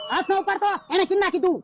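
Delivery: normal talking voice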